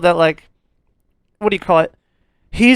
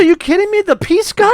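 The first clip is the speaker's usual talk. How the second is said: mock disbelief; 10 semitones higher than usual. Retelling his shock at twist.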